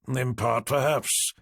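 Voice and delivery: usual speaking voice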